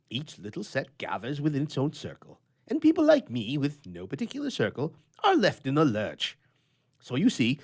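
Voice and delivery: a lonely grouch airing his gripes; grumpy old bachelor. A narrator's reading. Bachelor Bluff voiced in story.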